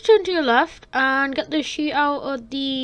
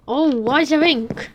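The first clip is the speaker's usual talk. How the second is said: normal talking voice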